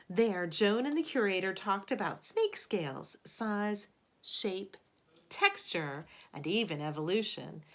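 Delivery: expressive picture-book narration; storybook read-aloud voice; reading to children. Acted. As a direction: read it aloud to a class of kids. Reads picture book to class.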